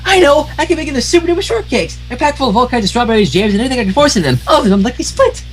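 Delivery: very high and fast excited babble; super fast, super high pony gush; rapid-fire Pinkie. Acted. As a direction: rattle it off breathlessly. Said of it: "Is that too fast even for Pinkie Pie standards".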